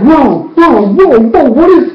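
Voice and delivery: a crowd reeling at the news; stunned crowd. Retold or imitated. Crowd hearing about Tarlock's family, amazed whoas. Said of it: "Everybody's like".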